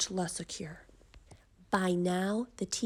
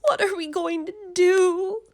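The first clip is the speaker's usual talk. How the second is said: much higher, loud anguished mother; desperate cry; 9 semitones higher, 10 dB louder than usual. A narrator's reading. Mother pleads for help for her daughter, high and loud. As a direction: wail it in a panicked mother's voice. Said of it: "cried Missus Cream".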